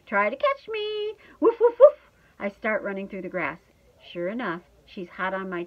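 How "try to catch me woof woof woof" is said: high, loud, teasing dog; playful barking. A narrator's reading. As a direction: taunt gleefully, then woof. Dog teases owner into chase.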